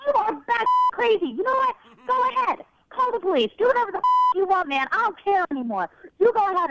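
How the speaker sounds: fed up, telling him off; angry. Real, not acted. Prank victim losing her temper.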